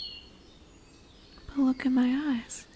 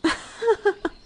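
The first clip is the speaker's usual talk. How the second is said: high burst of amused laughter; short, high laugh in character; 8 semitones higher, 4 dB louder than usual. Acted. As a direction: laugh at a foolish human. Amused at human's death wish.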